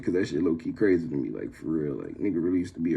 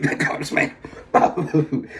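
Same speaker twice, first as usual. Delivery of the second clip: wheezing with laughter; laughing retelling; 7 semitones higher, 3 dB louder, slower than usual. Retold or imitated. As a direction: repeat the complaint through helpless laughter. Repeats friend's complaint while laughing.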